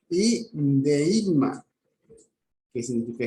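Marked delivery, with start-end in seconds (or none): 0.1-1.7 deigma drawn out very slowly; very slow Greek word. Shown as a demonstration. Teacher spelling out Greek roots: very slow pronunciation. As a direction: stretch out deigma slowly, sound by sound. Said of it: "significa junto".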